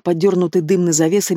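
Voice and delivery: plain reading voice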